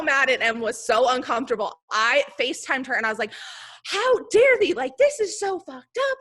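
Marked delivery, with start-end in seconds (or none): 3.8-6.2 higher, louder mock-outraged yell; replaying her own outburst, hammed up high and loud; retold screaming rant. On FaceTime with her friend, ranting about the bonus in a high, loud mock yell. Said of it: "And I was screaming".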